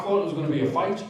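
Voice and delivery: ordinary delivery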